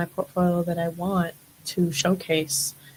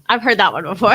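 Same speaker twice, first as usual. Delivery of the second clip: louder, laughing repeat; 2 semitones higher, 9 dB louder than usual. Real, not acted. Model on being called a bimbo, laughing it off loudly. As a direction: let a laugh break into the words.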